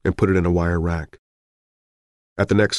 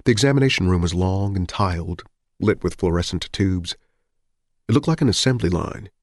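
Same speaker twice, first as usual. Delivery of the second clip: usual speaking voice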